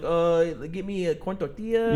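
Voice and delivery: put-on low, slow order with Spanish-accented "corn tortilla"; soft, drawn-out mock Spanish pronunciation.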